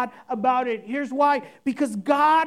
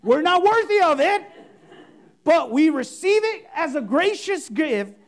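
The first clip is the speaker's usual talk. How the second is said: louder, slow, hammed-up grand prayer voice; pompous mock prayer; 4 semitones higher, 6 dB louder than usual. Pastor parodying a showy spiritual prayer, grandly hammed up. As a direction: overblown old-English prayer, milking every pause. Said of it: "Nobody talks like that".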